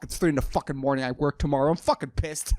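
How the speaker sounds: ordinary talk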